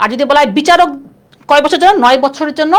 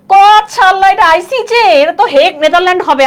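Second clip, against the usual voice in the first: higher, louder mock exclamation; student's sudden realisation; 5 semitones higher, 4 dB louder than usual. Student realises the exam answer she missed, imitated in high dismay. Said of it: "যখন আমি কইলাম যে".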